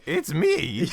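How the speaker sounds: high, hammed-up crazy character voice; cartoonish high-pitched entrance; Jenkins. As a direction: announce yourself as a silly recurring NPC. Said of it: "as soon as I hear that voice".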